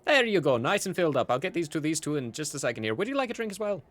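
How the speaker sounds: brisk cheery barkeep, high lilting offers; Gideon. Acted. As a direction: serve drinks with eager hospitality.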